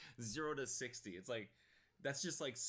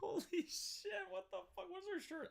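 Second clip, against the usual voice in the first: stunned by the grandma's threat; shocked disbelief. Real, not acted. Streamer stunned by the grandma's outburst, high.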